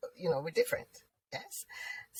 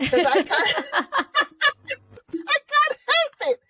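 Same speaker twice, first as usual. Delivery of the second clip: helpless laughter; 13 semitones higher than usual. Real, not acted. Speaker admits they can't help laughing, laughing helplessly. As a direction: burst out laughing and talk through it.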